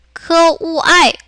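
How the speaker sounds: 크우아이 split slowly into 크, 우, 아이; slow, separated syllables in 크우아이; sounded out separately. Shown as a demonstration. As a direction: break 크우아이 apart for learners. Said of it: "이것도 우리 분리해서".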